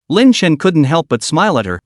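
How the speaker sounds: plain reading voice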